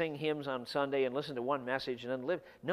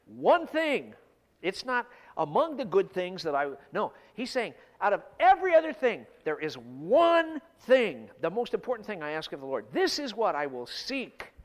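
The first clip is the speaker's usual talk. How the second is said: "One thing" hit loud, "one" stretched out; emphatic; 2 semitones higher, 8 dB louder than usual. Acted. Preacher voicing the psalmist's single request, loudly emphatic. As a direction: punch "one thing" and "This is what I will seek", louder. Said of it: "The language in verse four is emphatic language".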